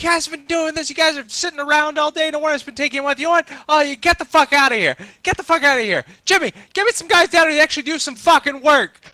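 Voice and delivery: high-pitched furious rant at the workers; angry boss yelling. Retold or imitated. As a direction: scream at the lazy crew, voice way up. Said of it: "He's like".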